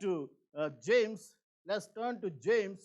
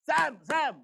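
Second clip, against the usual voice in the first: Sam, Sam called louder and higher; urgent calling; 5 semitones higher, 7 dB louder than usual. Shown as a demonstration. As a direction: call Sam twice so he snaps to attention. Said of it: "Then he will be alert".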